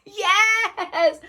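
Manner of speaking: high, squealed yes; thrilled yes. Real, not acted. Vlogger thrilled by her find, squealed yes. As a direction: squeak it out in delight.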